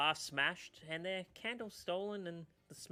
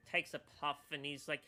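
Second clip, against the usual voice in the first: ordinary delivery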